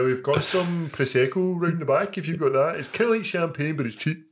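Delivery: high, put-on barman voice; mock barman. Barman pushing cheap Prosecco, a high put-on voice. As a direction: play the barman offering cheap fizz.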